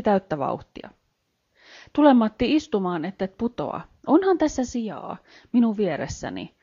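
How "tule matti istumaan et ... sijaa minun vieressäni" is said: ordinary delivery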